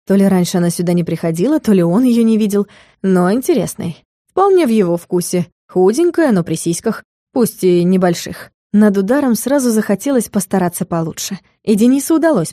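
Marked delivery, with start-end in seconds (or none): none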